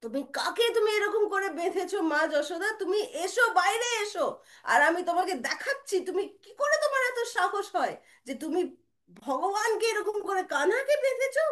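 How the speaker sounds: high, scolding challenge; angry loud calling. Acted. Brother ordering mother Yashoda outside, high angry calling. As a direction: call her out in indignation. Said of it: "এরকম করে জোর জোর গলায় চিল্লাচ্ছে".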